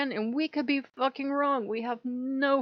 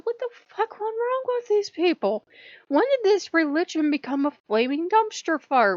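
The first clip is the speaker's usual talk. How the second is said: higher, incredulous rant, louder at the end; exasperated outburst; 8 semitones higher, 5 dB louder than usual. Retold or imitated. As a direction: blurt it out in disbelief. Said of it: "I said".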